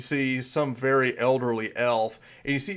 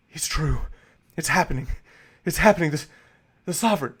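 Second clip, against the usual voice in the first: climbing higher and louder with each line; awed excitement; 4 semitones higher, 4 dB louder, faster than usual. Acted. Host staring at the burning torch, rising awe. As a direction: awe building to a peak. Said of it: "in bewilderment".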